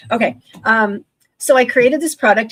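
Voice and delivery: usual speaking voice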